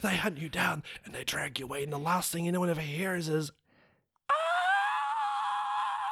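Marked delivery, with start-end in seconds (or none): 4.3-6.1 shrill scream. Acted. Retold victim's final scream.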